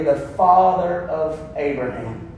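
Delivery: ordinary talk, nothing marked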